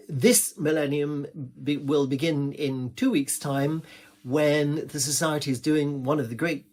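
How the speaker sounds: usual speaking voice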